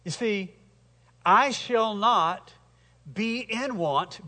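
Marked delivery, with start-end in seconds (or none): 1.2-4.3 very slow, pressing each word; slow emphatic rephrase. Shown as a demonstration. Preacher rewording the psalm for his hearers, slow and emphatic. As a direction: spell it out slowly for them. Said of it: "basically is what it says".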